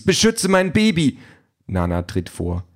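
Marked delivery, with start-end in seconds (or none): 0.0-1.2 high, urgent shouted plea; calling out. A narrator's reading. Mother calls on nanny to protect her baby, urgent. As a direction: cry the order out, pitched way up. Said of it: "ruft Denise".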